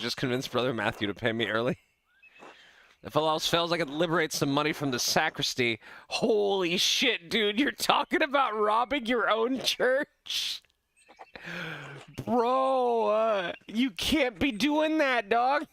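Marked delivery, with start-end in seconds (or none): 6.1-15.7 high, slow incredulous disbelief. Real, not acted. Gamer shocked that his character would rob the church, incredulous and high. Reacting to robbing own church.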